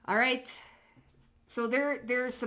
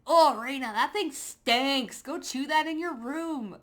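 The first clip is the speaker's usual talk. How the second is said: mock scolding the dog; 6 semitones higher, 5 dB louder than usual. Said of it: "I'm like".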